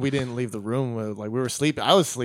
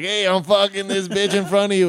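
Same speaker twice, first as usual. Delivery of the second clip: louder, higher mock boast; mock brazen announcement; 5 semitones higher, 5 dB louder than usual. Imagined John announcing to roommates, brazen. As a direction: announce it brashly to the room. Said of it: "John wasn't like".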